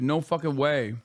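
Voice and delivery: ordinary talk, nothing marked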